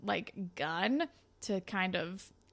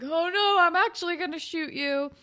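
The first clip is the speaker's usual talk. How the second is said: higher, louder mock threat; a lightly hammed-up gunman; 8 semitones higher, 5 dB louder than usual. Robber threatens Spinner, higher and louder mock menace. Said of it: "the guy is like".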